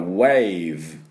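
slow wave ending in a buzzing v. Shown as a demonstration. Teacher modelling wave slowly. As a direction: stretch wave and buzz the end. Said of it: "ブって振動させて終わってください".

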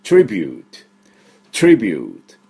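トリビュート in English, falling to a light final t; English pronunciation. Shown as a demonstration. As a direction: say トリビュート with fused "tr" and long "yoo". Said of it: "っていう音になるんですね".

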